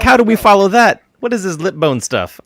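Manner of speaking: high, loud mock-baffled crowd voice; a hammed-up puzzled performer wondering how to follow that; baffled audience. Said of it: "They're like".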